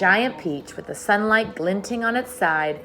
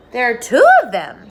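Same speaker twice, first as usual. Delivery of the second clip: loud; 5 semitones higher, 10 dB louder than usual. Acted. Miss Spider spots the shapes.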